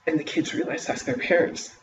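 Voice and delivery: ordinary delivery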